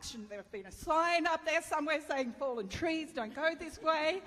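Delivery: high, loud indignant rant; angry complaining. Retold or imitated. Wife ranting at her husband on the trail, indignant. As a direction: blame him for every fallen tree. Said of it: "I'm just angry".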